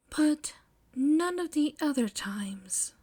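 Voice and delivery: whispered. Acted. May quietly clarifying.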